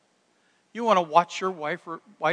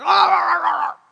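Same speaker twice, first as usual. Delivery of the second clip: high, loud wordless yelling; loud mock roaring; 11 semitones higher, 10 dB louder than usual. Football player firing up the team, loud mock roaring. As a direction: imitate a noisy player hollering nonsense. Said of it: "guys who were like".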